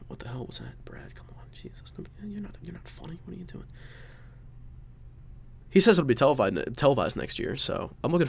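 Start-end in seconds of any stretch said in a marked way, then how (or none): 0.1-3.7 whispering. Real, not acted. Whispered aside scolding Brad.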